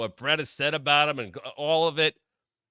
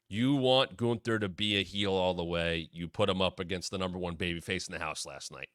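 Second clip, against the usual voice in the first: ordinary delivery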